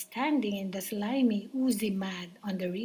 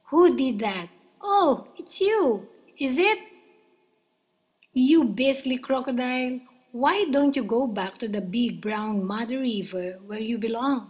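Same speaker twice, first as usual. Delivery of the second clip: angry elephant; 3 semitones higher, 7 dB louder than usual. Acted. Elephant scolding croc.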